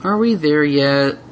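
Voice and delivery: slow, bored drawn-out question; whiny. Acted. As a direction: wear her down. Repeated whine.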